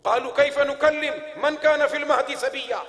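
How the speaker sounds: forceful scriptural quote; preacher's recitation. Real, not acted. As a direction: ring out the verse to the congregation. Recites scripture within sermon.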